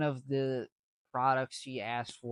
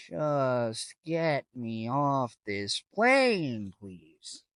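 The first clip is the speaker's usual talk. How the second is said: exasperated plea; 5 dB louder, much slower than usual. Retold or imitated. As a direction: stretch it out like you are desperate to escape. Own exasperated plea, retold.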